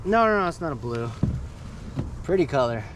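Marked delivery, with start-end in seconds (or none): none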